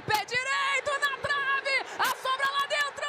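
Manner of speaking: rising excitement. Real, not acted. Building to goal.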